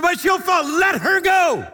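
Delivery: high, loud, slow final command; loud shouted order. Retold or imitated. Wigglesworth over the ladies' objection, a slow loud command. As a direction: hammer the order home.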